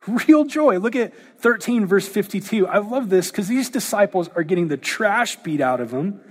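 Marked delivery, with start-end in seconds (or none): 0.1-0.8 higher, louder real joy; emphatic. Acted. Preacher exclaiming to congregation, emphatic joy. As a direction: punch it out with delight.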